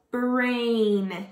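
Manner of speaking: "Brain" drawn out, sounds stuck together; slowly blended word. Shown as a demonstration. Teacher blending sounds for kids, "Brain" stretched slowly. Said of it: "you're going to stick it together".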